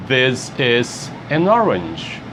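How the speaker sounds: slow, clear teacher model of "This is an orange"; slow model phrase. Shown as a demonstration. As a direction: spell it out slowly for beginners. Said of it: "model phrase for class".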